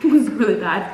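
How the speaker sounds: usual speaking voice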